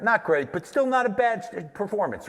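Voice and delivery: high-pitched conceding verdict; grudging approval, imitated. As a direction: lift the voice, acting out a forgiving viewer. Acts out a viewer's grudging verdict.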